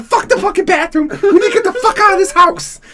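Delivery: high-pitched exasperated shout; panicked yelling. Retold or imitated. Son rushing mom out of smoky house, frantic retold yell.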